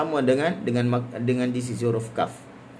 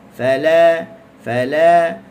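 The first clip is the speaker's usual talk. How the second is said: "fala" with la leaned on, louder; gentle pressure on lam; 5 semitones higher, 10 dB louder, much slower than usual. Shown as a demonstration. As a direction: lean into the la of "fala". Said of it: "Kita gentle pressurekan sedikit di huruf lam". ("fala" dengan tekanan pada huruf lam)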